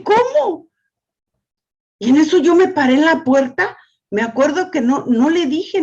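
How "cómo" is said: high disbelieving gasp of a question; retold shock. Grandmother retells her shock at the tiny girl being spanked, high disbelief. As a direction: replay her stunned thought, much higher.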